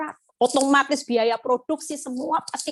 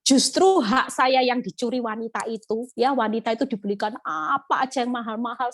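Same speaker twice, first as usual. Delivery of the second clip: normal talking voice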